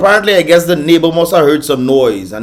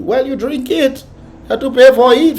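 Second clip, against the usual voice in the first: high, slow scolding retort; mock scolding; 9 semitones higher than usual. Mocking the customer who drank juice, high scolding. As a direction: scold him like he's foolish.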